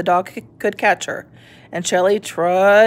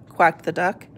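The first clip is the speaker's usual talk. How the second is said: ordinary delivery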